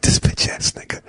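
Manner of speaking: muttered insult. Retold or imitated. Dad muttering insult at son.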